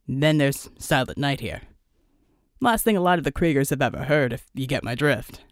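low squad leader with a knowing joke; team lead Flack. Acted. Flack introduces Silent Knight.

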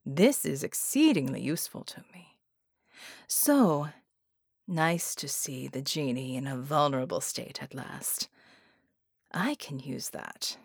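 a hushed, pleased menace; scheming murmur. Acted. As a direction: savour the advantage quietly. Sinister scheming aside.

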